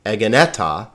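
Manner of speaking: "egeneta" stressed on the second "e"; misplaced third-syllable stress. Shown as a demonstration. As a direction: say e-gen-E-ta, weight on the third syllable. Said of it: "not egeneta".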